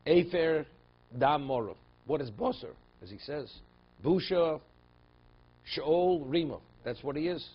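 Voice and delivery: loud, high-pitched, forceful teaching; emphatic preaching. Real, not acted.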